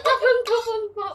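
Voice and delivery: laughing. Real, not acted. Laughing from the spice.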